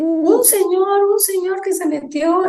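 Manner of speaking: high, drawn-out imitation of an alarmed child; retold alarm. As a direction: lightly act out a scared girl, stretching the words. Retold frightened girl reporting intruder. (susto infantil imitado, agudo y alargado)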